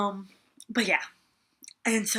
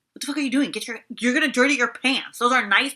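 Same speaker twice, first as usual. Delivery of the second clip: mock scolding; 3 semitones higher, 6 dB louder than usual. To Garrett on one knee in the dirt, a mock scolding yell. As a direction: replay the panicked telling-off. Scolding kneeling boyfriend about jeans.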